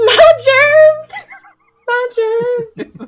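loud, high squeaky yelp; Scary Movie quote. Retold or imitated. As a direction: shriek it like the movie. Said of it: "anything anybody remembers from Scary Movie".

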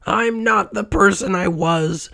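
high, desperate cry; Scrooge. A narrator's reading. Old miser pleads at his grave, high desperate cry. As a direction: plead in a high voice without shouting. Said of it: "Scrooge cried".

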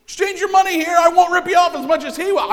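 high, loud barker shouting fast; carnival barker shout. Retold or imitated. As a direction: yell it like a money changer at a fair. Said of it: "Maybe he's a carnival barker".

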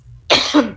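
sudden loud cough; coughing fit. Real, not acted. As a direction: cough hard mid-sentence. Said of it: "me ha dado un ataque de tos".

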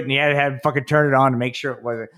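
normal talking voice

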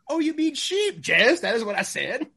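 mock exasperated reply. Retold movie dialogue, sheep reveal.

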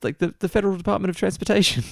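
a hint of Lisa's fast nerdy rant. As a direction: rattle it off as Lisa. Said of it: "one of my favourite Lisa rants".

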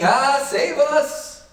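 high, slow, drawn-out panicked cry of Ah, save us; a hammed-up terrified plea; mock panic. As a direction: act out the frightened disciples in the boat. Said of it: "they're panicking".

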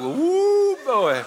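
high, loud woo of delight; excited whoop. Real, not acted. Pastor to congregation recalling his hair, delighted woo. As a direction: whoop like it still impresses you. Admiring his old afro.

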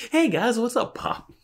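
high put-on greeting voice. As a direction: squeak out a mock hello way up high.

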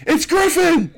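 sudden high, loud eureka cry; excited shout. Retold or imitated. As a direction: shout it out, finally remembered. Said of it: "I'm like".

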